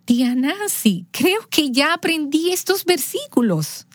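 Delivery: climbing high in wonder; a boy who can't believe what he just did; amazed boy. Retold or imitated. Nephew telling his aunt he already learned the verses, amazed.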